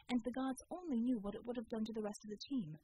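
usual speaking voice

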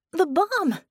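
high, startled yelp. A narrator's reading. Vicky reacts to Eight's reminder of danger, yelping. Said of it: "she yelped".

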